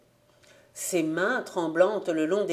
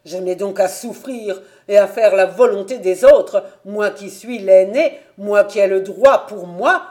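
loud, aggrieved protest; pent-up anger; 10 dB louder than usual. A narrator's reading. Eldest son protesting to father against obeying others, angry. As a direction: pour out the long-held reproach, loudly. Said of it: "toutes les colères autrefois contenues passaient".

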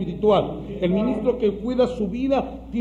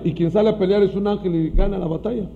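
ordinary delivery

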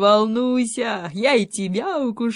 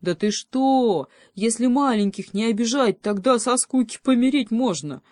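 higher-pitched, indignant protest; offended; 6 semitones higher, 4 dB louder than usual. A narrator's reading. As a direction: object with wounded disbelief. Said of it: "обиделся волчонок". (обиженно, с возмущением)

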